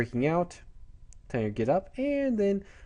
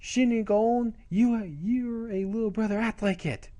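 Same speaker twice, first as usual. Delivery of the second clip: high-pitched mock indignation; acted sibling retort; 10 semitones higher than usual. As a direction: scold your little brother in a high voice. Usagi snaps back at brother.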